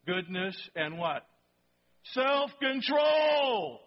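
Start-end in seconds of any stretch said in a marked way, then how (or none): 2.1-3.8 loud, high and very slow shout; shouted answer. Real, not acted. Pastor shouts the answer to the congregation, loud and drawn out. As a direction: bellow the word out to the room.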